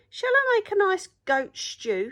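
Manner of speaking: high, loud cheerful troll offer. A narrator's reading. Troll offers pirates goat stew, high and loud.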